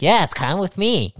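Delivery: high, eager little creature voice; beckoning in a squeaky voice; Rats voice. Acted. GM voicing Rats inviting the party in, high and eager.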